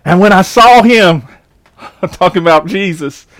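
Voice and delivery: slow scripture reading. Acted. Reads scripture slowly.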